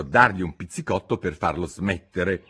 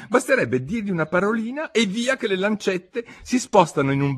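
Hatter; 7 semitones higher, 4 dB louder than usual. Acted. Hatter voiced.

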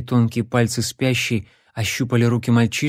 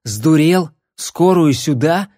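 angry; 4 semitones higher, 4 dB louder than usual. A narrator's reading. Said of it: "бешенства".

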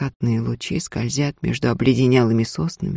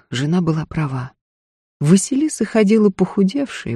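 ordinary delivery, nothing marked

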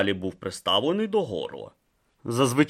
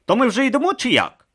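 higher, pressing question; impatient; 6 dB louder, faster than usual. Acted. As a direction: hurry them along. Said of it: "нетерпляче запитав він".

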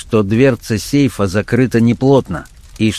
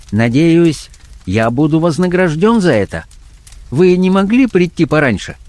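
high-pitched voice of a condescending blackmailer; blackmailer character voice; 7 semitones higher than usual. Acted. Blackmailer chiding his late night visitor, condescendingly. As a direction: play the blackmailer, high and patronising.

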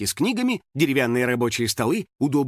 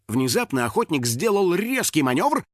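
usual speaking voice

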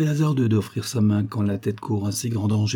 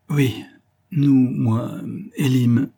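halting, word by word with pauses, slightly raised; stammering; 3 semitones higher, 3 dB louder, much slower than usual. A narrator's reading. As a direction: falter through a nervous confession. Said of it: "balbutia celle-ci".

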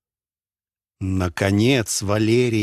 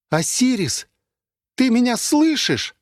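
much higher, calling the AI. Acted. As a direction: call into the dark, hoping. Repeated call to the AI.

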